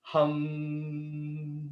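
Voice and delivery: soft long hum; humming. Shown as a demonstration. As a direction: hum like bees. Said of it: "bring that right up into your mouth".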